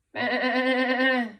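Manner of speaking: loud, high wavering bleat; sheep's bleat. A narrator's reading. Teacher reading a farm story to children, sheep's bleat. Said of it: "said the sheep".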